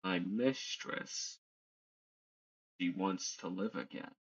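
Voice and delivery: slow, soft servant of a mistress; hushed, deliberate in-character reveal; cryptic servant. Acted. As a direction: speak slowly and softly, as if revealing a secret. Game master voices the helmeted figure.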